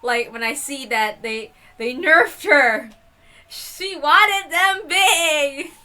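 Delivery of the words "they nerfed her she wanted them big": slow, louder, higher whiny complaint; playful fake sob over a nerf; mock whine. Real, not acted. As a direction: whine it half-laughing, slowly. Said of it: "I have to make a complaint".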